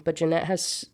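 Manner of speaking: ordinary talk, nothing marked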